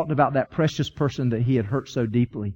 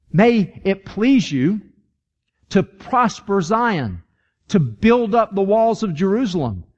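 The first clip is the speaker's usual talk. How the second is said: slow, raised, declaimed verse; stately psalm reading, pitched up; scripture recitation; 4 semitones higher, slower than usual. Acted. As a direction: proclaim it phrase by phrase with long pauses. Reads psalm verse aloud.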